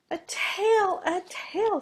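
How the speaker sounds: high, loud little lamb squeal. A narrator's reading. Youngest lamb spotting the rooster's new tail, squealing. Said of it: "squealed the youngest of the lambs".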